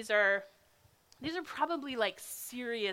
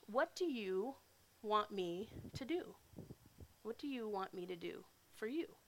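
gentle Jesus; 6 dB quieter than usual. Acted. Jesus gently asks the blind man, soft and tender. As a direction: ask kindly, inviting an answer.

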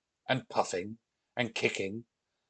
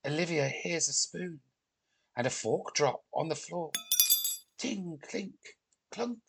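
soft, higher-pitched suspense; hushed narration; 6 semitones higher, 6 dB quieter than usual. A narrator's reading. Narrator in the dark after thunder, hushed. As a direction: tiptoe through the words, fading to tiny clinks.

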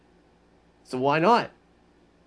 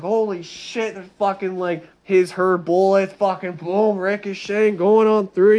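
higher, louder retold battle panic; excited exclamation; 6 semitones higher, 5 dB louder than usual. Vlogger retells being caught in a firefight, loud excitement. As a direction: relive the chaos as it happens.

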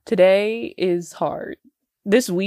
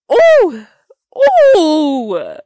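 loud high wince; pained ooh; 10 semitones higher, 12 dB louder than usual. Real, not acted. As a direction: cry out at the price. Pained reaction to prices.

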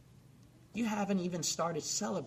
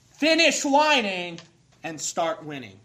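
higher, much louder and slower, each word hammered; shouted slogan; 4 semitones higher, 14 dB louder than usual. Acted. Preacher rallying the church, full-voiced shout. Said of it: "sermon slogan, shouted".

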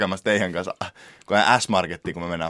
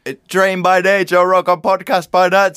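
high, loud, slow podcast-intro chant; Joe Rogan; 9 semitones higher, 9 dB louder, slower than usual. Retold or imitated. Host imitating a famous podcast intro, loud announcer impression. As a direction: belt it out like a show's slogan. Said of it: "Joe Roganissa on silleen".

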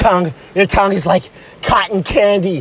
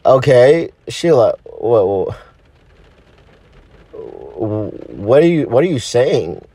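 very low, halting confusion; hurt, hesitant low voice; wounded boyfriend; 13 semitones lower than usual. Acted. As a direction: stammer it low, taken aback. Boyfriend taken aback by her coldness.

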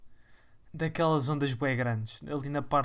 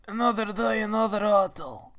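mock youtuber intro; 5 semitones higher, 5 dB louder, slower than usual. Impersonates youtuber Cláudio André.

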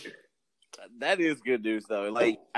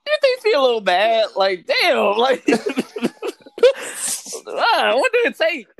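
laughing; 10 dB louder than usual. Real, not acted. Laughing about the recovered grandmother.

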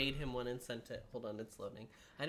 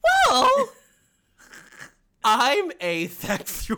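squeaky high cartoon king; King Candy; 10 semitones higher, 16 dB louder, slower than usual. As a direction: declare it proudly in character. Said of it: "I sound like the Mad Hatter".

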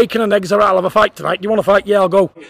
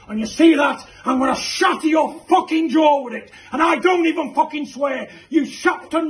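threatening shout; 7 semitones higher than usual. Acted. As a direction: yell the threat straight at him.

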